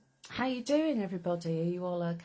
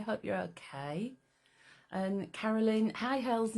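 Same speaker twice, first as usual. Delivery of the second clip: usual speaking voice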